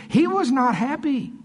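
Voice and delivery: high-pitched, emphatic exasperation; high, insistent retelling; exasperated. Retold or imitated. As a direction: stress how displeased he was, pitched high. Acting out Jesus' displeasure.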